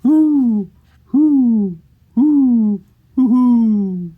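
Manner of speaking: high, loud, long owl hoots. Acted. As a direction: hoot like an owl, doubling the last. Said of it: "What do the owls say?".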